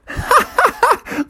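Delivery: high kiddie cackle; mocking laugh. Other kids mock the bears.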